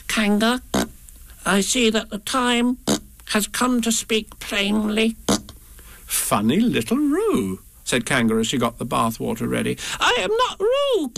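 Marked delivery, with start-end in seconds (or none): 0.1-5.2 high, slow, trying-to-be-brave small voice; brave Piglet. Acted. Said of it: "in as brave a voice as he could". 6.1-7.6 slow, musing high mother voice; thoughtful. Acted. Kanga voiced in story. 10.0-11.1 very high squeaky Piglet protest; loudly. Acted. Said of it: "said Piglet loudly".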